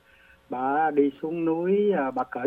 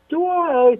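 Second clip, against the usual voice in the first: high loud cry to God; 11 semitones higher, 6 dB louder than usual. Retold or imitated. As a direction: shout it out in alarm. Tên cướp kêu Chúa. (tên cướp kêu to giọng cao)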